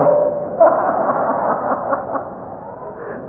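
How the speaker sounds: high breathy burst of laughter; real laugh. Real, not acted. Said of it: "Tôi nghe tôi cười quá trời". (tiếng cười thật, cao và bật ra)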